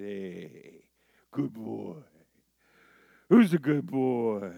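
acted pet talk, swelling on the question; talking to a dog. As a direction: coo praise at an old dog while petting it. Petting and praising an old dog.

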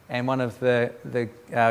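ordinary talk, nothing marked

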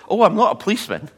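high, loud, fast protest; quick mock denial. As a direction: hurry the denial out. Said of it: "I went".

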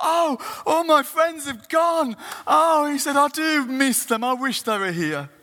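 high, slow, loud lonely wail; loud lament. Acted. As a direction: cry out for lost friends, slowly. Lonely third man wails.

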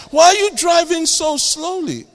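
high, loud exasperated question; mock-exasperated shout; impatient demand. Acted impatient passenger.

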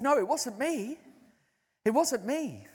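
the preacher tells the healed man it wasn't him; insistent denial. Retold or imitated. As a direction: brush off the credit firmly. Insisting he didn't heal.

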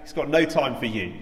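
mock angry yell from the crowd; acted shout. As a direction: shout down the beggar, hardest on the first words. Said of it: "shouts out".

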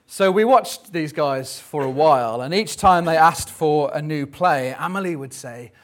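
normal talking voice

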